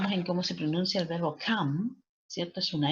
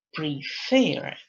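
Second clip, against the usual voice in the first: prefer with a strong second syllable, pre-FER; final-syllable stress; 4 dB louder than usual. Shown as a demonstration. Teacher giving an example, prefer stressed on last syllable. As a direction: punch the end of prefer. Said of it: "la sílaba que está acentuada es la última".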